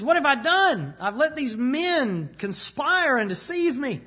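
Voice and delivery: a guilt-ridden king crying out; anguished. Retold or imitated. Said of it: "He's shaken".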